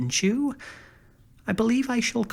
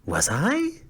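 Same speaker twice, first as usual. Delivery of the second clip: higher, delighted question; pleased; 6 semitones higher than usual. A narrator's reading. Scarecrow pleased by the punster's praise, delighted. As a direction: sound flattered and pleased. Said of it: "asked the scarecrow in a pleased voice".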